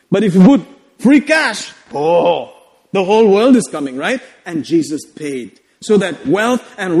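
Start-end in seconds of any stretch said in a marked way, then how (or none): none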